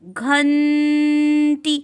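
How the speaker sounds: घंटी stretched very slowly so the half न sound rings; drawn-out ghanti. Shown as a demonstration. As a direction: linger on घंटी, letting the nasal न hum before ट. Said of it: "कुछ इस प्रकार".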